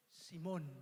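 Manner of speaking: very soft, low murmur of the name; tender hush. Acted. Jesus speaking softly to Simon, tender hush. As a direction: call him gently, almost under breath.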